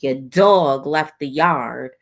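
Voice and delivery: slow, drawn-out announcement; pointed slow delivery. Retold or imitated. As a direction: stretch the words out to make the point. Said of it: "You had to say it like that".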